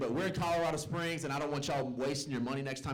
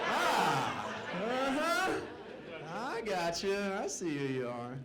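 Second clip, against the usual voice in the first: knowing tease; 4 semitones higher, much slower than usual. Acted. Teasing knowing reply to crowd.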